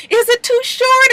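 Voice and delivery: high-pitched coy question; mock seductive. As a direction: play the flirt in a high, teasing voice. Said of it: "she gonna seduce him".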